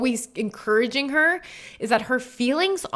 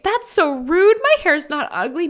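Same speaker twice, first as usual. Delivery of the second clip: mock offended; 7 semitones higher, 4 dB louder than usual. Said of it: "Somebody might think".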